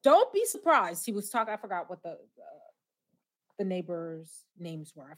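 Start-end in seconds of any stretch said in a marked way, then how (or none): 0.0-1.0 louder, higher Don't be surprised; louder warning from George Jefferson. Retold or imitated. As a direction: bark it like a warning.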